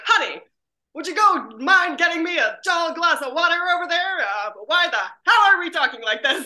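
hammed-up vintage film star calling out; old movie voice. Pitching a dream episode, hammed-up old movie voice. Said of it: "they're talking like this".

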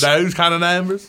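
imitating a Southern Alabama accent; Auburn accent. Co-host copying the Auburn accent, mock Southern. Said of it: "that was my Auburn accent".

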